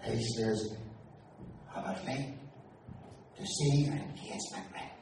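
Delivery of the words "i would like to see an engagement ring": soft, hushed request; whispered. Retold or imitated. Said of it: "I whispered".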